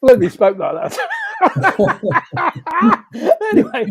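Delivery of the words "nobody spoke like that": laughing outburst, louder; blurted through a laugh; real laugh at the memory. Real, not acted. Laughing at old jargon.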